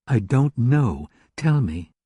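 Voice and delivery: ordinary delivery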